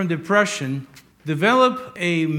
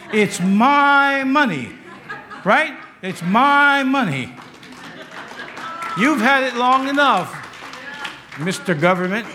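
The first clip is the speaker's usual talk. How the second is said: raised, slow, pounding preacher's declarations; fiery preaching; 5 semitones higher, 5 dB louder than usual. Acted. Preacher to congregation, demanding their joy back, fiery and raised. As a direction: drive it home like a revival preacher, laughing between lines. Preaching builds to full force.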